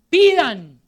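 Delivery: loud, high cry; shouted command. Retold or imitated. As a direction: call it out as an urgent order. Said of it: "porque el Señor grita".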